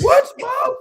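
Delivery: high, loud yell back at mom; mock kid yelling. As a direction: holler back like an annoyed kid.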